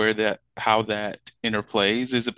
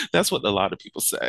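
laughing; 3 dB louder, faster than usual. Real, not acted. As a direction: laugh through a quick joke. Laughing at flirty banter.

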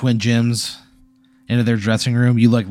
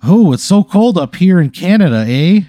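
high, loud commercial Mountie voice; hammed-up Canadian pitchman, louder and higher; 7 semitones higher, 6 dB louder than usual. Mountie riding a stick horse in a commercial, hammed-up. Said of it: "dressed up like a Mountie".

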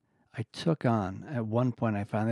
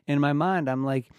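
normal talking voice

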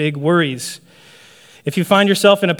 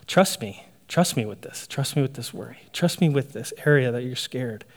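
low, soft, patient reassurance; reassuring God; 4 semitones lower, 8 dB quieter than usual. Acted. God to a scared believer, softly reassuring. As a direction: lower and soften, gently repeating the invitation. Voicing God's reply to the believer.

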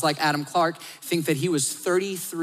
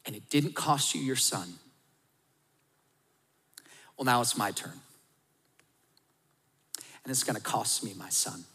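God's solemn words; 5 semitones lower, 8 dB quieter than usual. Pretend. God tells Abraham softly that now it will cost His son. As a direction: let the cost sink in quietly.

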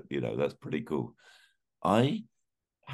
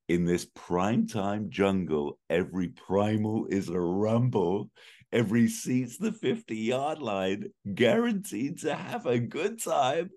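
building dramatic reading; 4 semitones higher than usual. Pretend. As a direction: build the lyric to a big finish. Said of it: "My favorite bit's coming up".

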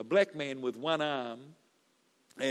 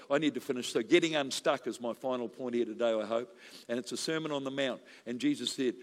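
ordinary talk, nothing marked

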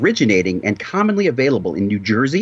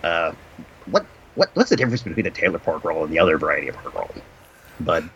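a hint of a low, quiet interviewer; lightly voiced low talk-show host; softly playing a host asking his guest; Doug McCoy voice; 6 semitones lower, 5 dB quieter than usual. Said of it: "This is me, Doug McCoy".

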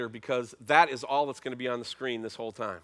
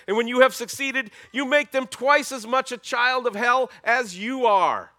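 loud, high preaching rebuke; Jesus raising his voice; 7 semitones higher, 6 dB louder than usual. Acted. Jesus rebuking the Pharisees before the crowd, loud. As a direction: hammer each charge home. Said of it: "he's gonna raise up his voice".

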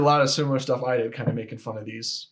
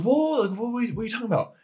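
higher, baffled "whoa, whoa"; retold alarm; 10 semitones higher, faster than usual. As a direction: replay the shock of not understanding. Confused boyfriend caught off guard.

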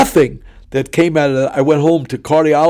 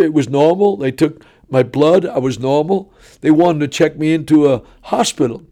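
ordinary talk

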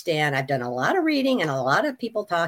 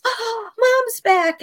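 much higher, slow sing-song daughter voice; imitating daughter; 5 dB louder, much slower than usual. Daughter greeting her mother back, mimicked high and slow. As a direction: stretch it out high and sweet like a daughter. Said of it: "my daughter turns around and says".

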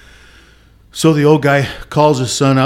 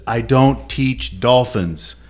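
slow punchline; much slower than usual. Acted. Punchline delivered slowly.

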